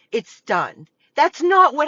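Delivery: ordinary talk, nothing marked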